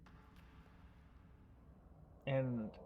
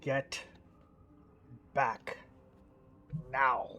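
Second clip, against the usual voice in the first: low, held-back menacing order; through clenched teeth; 3 semitones lower, 3 dB quieter, slower than usual. Acted. King warning the thief off his hair, through clenched teeth. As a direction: say it tight and restrained. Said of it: "His teeth clenching".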